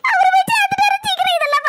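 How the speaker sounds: high, sobbing cry over the dead husband; grieving widow breaking into sobs. Acted.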